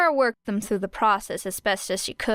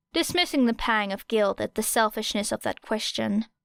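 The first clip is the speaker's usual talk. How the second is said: ordinary delivery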